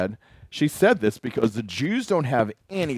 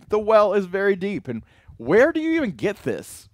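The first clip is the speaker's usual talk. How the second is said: sarcastic woman; 9 semitones higher, 4 dB louder than usual. Retold or imitated. Preacher voicing the Samaritan woman's sarcasm to Jesus. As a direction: needle him with a raised voice. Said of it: "I almost hear sarcasm in her statements".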